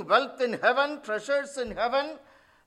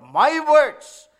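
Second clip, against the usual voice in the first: "my words" said much louder; stress on my words; 3 semitones higher, 9 dB louder than usual. Shown as a demonstration. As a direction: punch "my words" out loud. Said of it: "emphasis on my".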